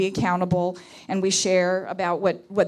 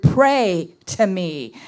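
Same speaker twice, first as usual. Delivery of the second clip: slow, louder "pray to me", leaning on "pray"; stress on pray to me; 4 dB louder, slower than usual. Shown as a demonstration. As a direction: draw out "pray to me" with weight on "pray". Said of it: "I want to emphasize the word".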